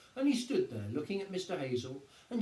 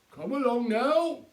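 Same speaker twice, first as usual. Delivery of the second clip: higher, louder, slow brisk order; policeman ordering crowd; 8 semitones higher, 9 dB louder, slower than usual. A narrator's reading. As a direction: move the gawkers along. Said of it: "Sergeant Samways stalled".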